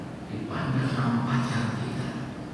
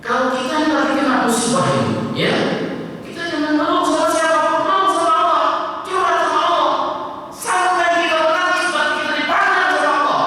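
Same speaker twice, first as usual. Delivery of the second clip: high, loud fervent sermon; impassioned preaching; 13 semitones higher, 7 dB louder than usual. Acted. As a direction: preach it with rising fervour. Preaching at full force. (khotbah berapi-api)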